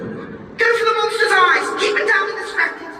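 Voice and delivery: high, excited cat voice with a laugh; rally the bird, gleeful and high; Donut commanding. Acted.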